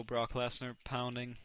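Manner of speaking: ordinary talk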